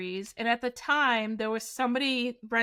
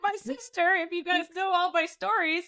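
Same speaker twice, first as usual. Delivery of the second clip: high voice breaking up with laughter; laughing; 6 semitones higher than usual. Real, not acted. Storyteller joking that sister wouldn't save her, real laughing. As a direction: talk through helpless giggles.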